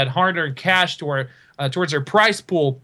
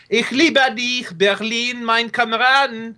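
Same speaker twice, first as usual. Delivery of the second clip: mock German gushing; 5 semitones higher, slower than usual. Gushing over the Berlin map.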